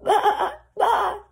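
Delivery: high, loud bleat; crying lamb. A narrator's reading. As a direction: bleat out in distress. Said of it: "Snowflake cried".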